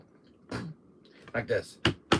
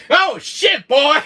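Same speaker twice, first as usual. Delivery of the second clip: startled shout; 9 semitones higher, 8 dB louder, much slower than usual. Retold or imitated. Jack bursts through the wall.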